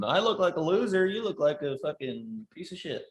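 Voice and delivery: self-heckle. Retold or imitated. Acting out heckling himself on stage.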